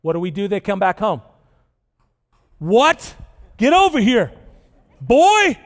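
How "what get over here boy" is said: put-on louder angry parent calling the kid over; scolding parent. Parent scolding the rebel kid who came home, loud and stern. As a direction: bark it like a fed-up dad.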